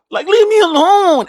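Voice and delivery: whiny protest. Retold or imitated. Bullied kid pushing teasers away, high loud whine. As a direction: wail it like a fed-up kid.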